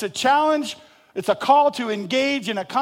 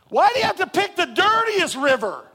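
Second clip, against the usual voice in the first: much higher mock whine; whiny complaint; 10 semitones higher, 4 dB louder than usual. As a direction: whine like a spoiled kid. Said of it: "I can hear me saying that".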